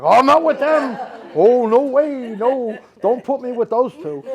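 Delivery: high, loud, squealing mock denial, drawn out; mocking audience member. Medium mocks an audience member's quick denial in a high, loud squeal. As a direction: mimic her quick denial in a shrill mock voice. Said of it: "You said that pretty quick".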